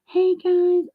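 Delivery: high, sugary mock greeting; imitating a sweet YouTuber's hello; imitating Alex. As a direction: chirp it like a sweet streamer. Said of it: "Alex is like".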